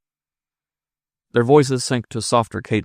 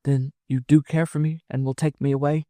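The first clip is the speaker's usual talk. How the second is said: higher-pitched woman's voice, hopeful; hopeful woman in love; 7 semitones higher than usual. Acted. Wife asking her lover to take her away, hopeful. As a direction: ask for reassurance in a high, yearning voice.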